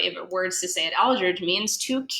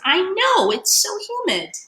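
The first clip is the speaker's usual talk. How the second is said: put-on much higher, whiny kid voice; complaining fish brother; 12 semitones higher than usual. Fish brothers complaining to each other, high whine. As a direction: whine about the heat. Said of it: "the other one says".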